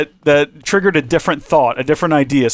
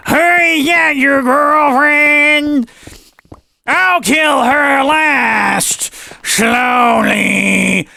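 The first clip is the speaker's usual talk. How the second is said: high, slow, shouted menacing alien; alien villain voice; 11 semitones higher, 3 dB louder, much slower than usual. Retold or imitated. Alien taunting the hero about his girlfriend, high shouted menace. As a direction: ham up a gloating space villain. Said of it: "an alien comes through the door and is like".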